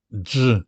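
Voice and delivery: ج said thick and heavy, slowly. Shown as a demonstration. Teacher showing learners the rare heavy ج, slow and thick. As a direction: drag out a heavy, velarized ج. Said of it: "ج مغلظة".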